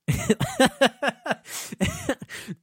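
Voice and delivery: high, far-off burst of laughter; delighted laugh. Real, not acted. Laughing with delight at the wrestling match.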